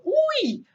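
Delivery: high-pitched pretend yelp; mock startled cry. Teacher showing what one says when tripping, mock startled yelp. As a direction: jump high as if startled, playfully. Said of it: "se eu levo um susto".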